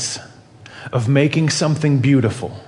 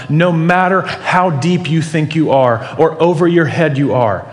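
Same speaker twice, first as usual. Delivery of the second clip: ordinary talk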